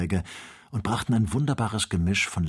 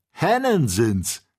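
slow, high and louder scoff; mocking; 11 semitones higher, 5 dB louder than usual. A narrator's reading. Joe deriding the ostriches as hens, mocking. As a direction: drag out the jeer at mere hens. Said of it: "höhnte Joe".

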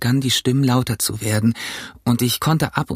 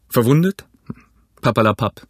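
brusque doctor; 3 dB louder than usual. Acted. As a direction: brush the worry off firmly. Doctor figure scoffs. (abwinkender, resoluter Doktor)

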